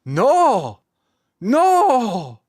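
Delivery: high, loud mock despair; anguished no. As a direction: wail it like a heartbroken kid. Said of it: "And I'm like".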